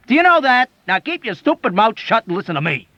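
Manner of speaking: high, loud, stress know; shouting. A narrator's reading. Soldier shouting at the doctor to shut up. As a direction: bark the order at him. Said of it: "shouted emphatically".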